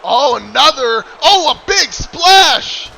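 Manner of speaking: bursting out at the big move; excited shout. Real, not acted. Commentator reacts to big splash.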